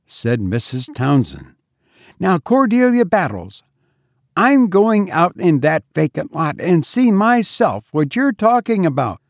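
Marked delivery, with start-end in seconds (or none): 2.2-9.2 high-pitched, no-nonsense declaration; resolute mistress. A narrator's reading. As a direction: announce it firmly to the maid. Mistress firmly announces she will look.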